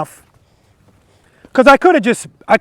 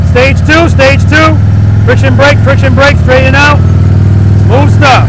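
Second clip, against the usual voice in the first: loud instructor calls over the engine; called out loud; 6 semitones higher, 9 dB louder than usual. Shown as a demonstration. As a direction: call each step out loud. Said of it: "I'm gonna do a demo for you guys".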